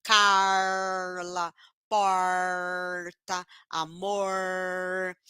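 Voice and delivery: CARLA, PORTA, AMOR slowly with a long curled-tongue R; retroflex R. Shown as a demonstration. Teacher showing the inland accent, drawn-out retroflex R. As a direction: curl the tongue back and hold the R. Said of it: "vão fazer com R retroflexo".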